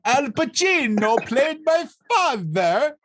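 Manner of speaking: hammed-up movie Italian accent, high and slow; asked to redo the film's Italian accent, hammed-up; fake Italian accent. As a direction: lay on a bad Italian accent. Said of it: "Just do the accent one more time".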